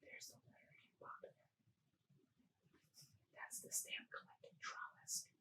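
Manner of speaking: hushed aside while showing the picture; whisper. Real, not acted. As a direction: whisper it, pointing at the page.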